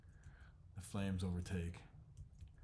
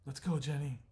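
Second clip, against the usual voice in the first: urgent call; 7 semitones higher, 6 dB louder than usual. Acted. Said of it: "He's like".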